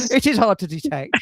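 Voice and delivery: chuckling as he repeats it; amused, laughing through the words. Real, not acted. Amused echo of guest's joke.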